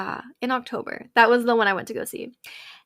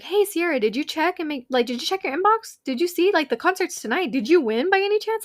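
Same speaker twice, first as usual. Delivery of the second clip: higher, eager stepmom asking; 6 semitones higher than usual. Retold or imitated. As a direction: imitate a stepmom nudging. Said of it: "my stepmom was like".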